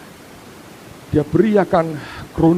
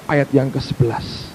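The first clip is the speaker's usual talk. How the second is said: usual speaking voice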